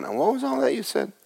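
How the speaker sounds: high, soft mock-confused voice; small high voice, as if lost; puzzled listener. Preacher imitating listeners who took no notes, mock puzzled.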